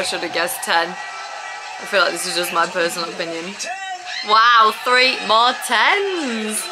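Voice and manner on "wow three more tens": drawn-out wow, rising to a loud wowee; amazed cheer. Real, not acted. Viewer seeing three more tens, amazed drawn-out cheer. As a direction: stretch it out, thrilled.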